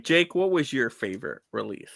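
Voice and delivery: ordinary delivery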